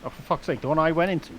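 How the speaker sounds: loud frustrated yelp; fed up, half shouting; exasperated outburst. Real, not acted.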